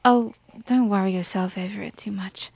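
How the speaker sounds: breathy whisper, brushing it off; whispered reassurance. Acted. Princess hushes her worried maid in a whisper. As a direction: wave away the worry in a whisper.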